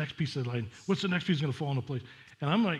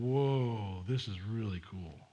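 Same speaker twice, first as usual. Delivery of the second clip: hushed awe; 4 semitones lower, 4 dB quieter, much slower than usual. Pretend. Imagined awe at prophecy.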